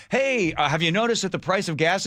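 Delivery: high, bright reporter voice asking a question; perky pitch-up of a street interviewer; mock TV reporter. Radio host imitating a TV reporter quizzing a driver, high. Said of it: "You'd think a T V station would go to a gas station".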